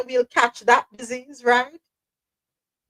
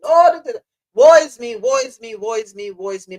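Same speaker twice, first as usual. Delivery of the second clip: mock woe is me; 7 dB louder than usual. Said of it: "you hear people complaining".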